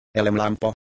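usual speaking voice

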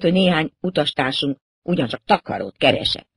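usual speaking voice

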